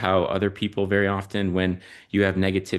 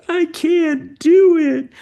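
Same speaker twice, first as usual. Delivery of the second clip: imitating a child's much higher, much louder frustrated wail, drawn out slowly; 10 dB louder, much slower than usual. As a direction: wail it like a little girl giving up at the piano, slow. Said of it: "she slams her hands down on the piano".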